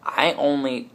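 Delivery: ordinary delivery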